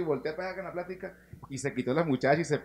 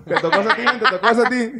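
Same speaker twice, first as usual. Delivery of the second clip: laughing; 7 semitones higher, 11 dB louder, faster than usual. Real, not acted. Teasing laugh after the story.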